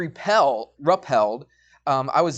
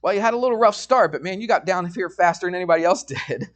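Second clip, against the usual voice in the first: higher-pitched retold guy at the bottom; lightly acted congratulation, pitched up; imitated rope handler; 5 semitones higher, faster than usual. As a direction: quote the impressed dude, lifted and quick at first. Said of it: "and he's like".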